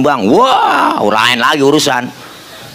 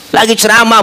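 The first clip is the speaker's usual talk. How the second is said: normal talking voice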